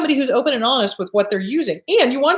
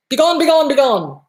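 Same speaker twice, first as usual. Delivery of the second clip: louder, higher be gone; commanding banish; 4 semitones higher, 4 dB louder than usual. Pretend. Therapist shows how to banish the intrusive thought, higher and louder command. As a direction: order the thought away like an exorcist.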